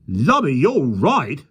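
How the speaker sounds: slow, loud startled exclamation. A narrator's reading. Policeman startled by the lion, loud and slow. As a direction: gasp it out, taken aback. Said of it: "said the policeman started".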